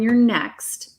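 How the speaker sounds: usual speaking voice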